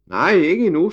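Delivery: put-on high, loud little girl's shout; yelling. A narrator's reading. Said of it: "skreg Ida".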